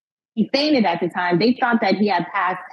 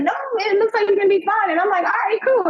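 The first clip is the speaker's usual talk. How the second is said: relieved quoting; 11 semitones higher, faster than usual. Retold or imitated. Paramedic reassures, he relaxes.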